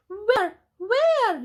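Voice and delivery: high frantic yell. Acted. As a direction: shout it high in alarm. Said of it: "yelled Plank".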